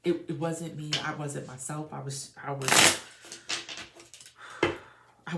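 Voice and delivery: loud, urgent plea; voicing an ex's anxious apology, loud; channeled pleading. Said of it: "if I can just tell you".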